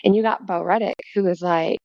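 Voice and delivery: normal talking voice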